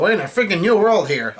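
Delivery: half-hearted imitation of yelling; mild mock yell. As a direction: exclaim it high, stopping short of shouting. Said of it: "Usopp yells out".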